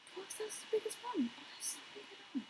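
quick high mutter. Real, not acted. Fast high whispered reading.

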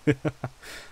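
short light laugh after a joke; amused laugh. Acted. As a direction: laugh it off playfully. Vampire host jokes about kidnapping.

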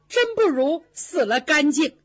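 ordinary delivery

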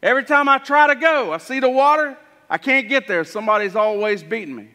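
high, pleading voice of the crippled man; lame man's lament. Acted. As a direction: cry out that you can't reach the water.

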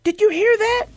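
a little hippo hearing a shriek in the dark; startled frightened question. Acted. Fritz hears shrieks, starts shaking.

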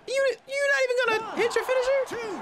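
high mocking taunt voice; raging gamer. Mocking the opponent onscreen.

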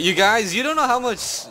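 loud, high frustrated rant; exasperated outburst. Real, not acted. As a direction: vent it loudly to the viewers.

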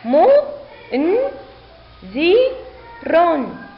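each sound alone with a pause after it; teacher's slow, separated syllables. Shown as a demonstration. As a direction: sound out mu - n - dhi - run. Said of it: "لنقرأ الكلمة".

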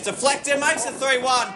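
high-pitched call of the goal; excited goal call. Real, not acted. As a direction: jump up for the goal. Commentator calls third goal.

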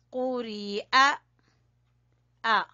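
कुरिया ending in a clean glottal-stop "a"; final आ of कुरिया as hamza, without throat squeeze; hamza, not ain. Shown as a demonstration. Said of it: "ये आइन नहीं है बेटा". (कुरिया के आख़िर में साफ़ हमज़ा, ऐन नहीं)

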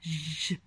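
ج held as a low, soft buzz; quiet, drawn-out ج on its own; isolated letter sound. Shown as a demonstration. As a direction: sustain just the sound of ج, no vowel. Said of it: "أصله إلى حرف الجيم".

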